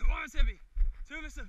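loud steady count. Real, not acted.

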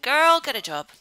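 loud, high sassy telling-off; mock scold. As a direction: sass her about getting a job. Scolding the Sim.

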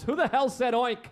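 high-pitched mock indignation; indignant farmer. Farmer in front of all his animals, indignant demand. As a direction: play a farmer interrogating the livestock. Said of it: "a farmer's in front of all of his animals".

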